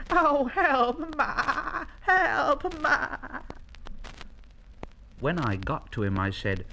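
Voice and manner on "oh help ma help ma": high, drawn-out bleating wail; wailing for help. Acted. Young llama stuck in litter basket calls Ma, wailing. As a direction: wail for mother in distress. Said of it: "still shouting".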